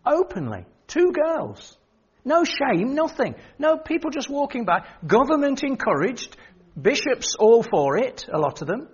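preacher decrying the nation, rising; impassioned preaching. Acted. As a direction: preach it with mounting indignation.

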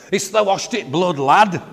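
high, loud Yorkshire challenge; broad Yorkshire accent. Retold or imitated. Some guy challenging a teenager, broad Yorkshire accent. Imitating a man accosting him as teenager.

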